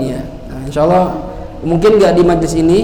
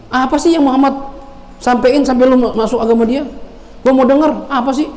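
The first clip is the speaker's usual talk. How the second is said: scornful question; 7 semitones higher than usual. Acted.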